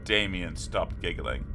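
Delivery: ordinary delivery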